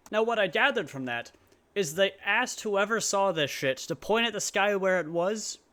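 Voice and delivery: usual speaking voice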